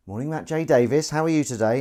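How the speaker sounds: hammed-up schoolmaster hello. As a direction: greet him like a stern old schoolmaster addressing the class. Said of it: "like a schoolmaster".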